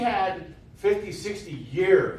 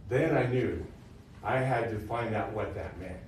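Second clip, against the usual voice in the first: ordinary talk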